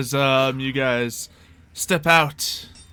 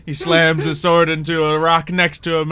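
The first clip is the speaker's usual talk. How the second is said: ordinary talk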